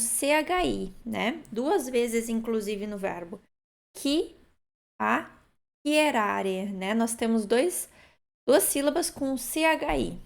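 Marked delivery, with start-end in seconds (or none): 4.0-6.7 Chiacchierare drawn out very slowly, each syllable apart. Shown as a demonstration. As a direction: spell out Chiacchierare slowly, kee-ah-kee-eh-rah-reh. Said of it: "C H I em italiano nós pronunciamos chi".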